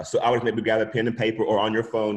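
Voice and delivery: normal talking voice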